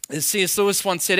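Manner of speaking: ordinary talk, nothing marked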